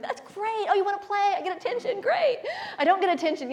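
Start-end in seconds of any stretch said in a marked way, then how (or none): 0.0-2.4 much higher gleeful dog voice; happy dog. Retold or imitated. Owner voicing her dog, pleased with attention. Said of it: "and be like".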